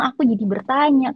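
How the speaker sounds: ordinary talk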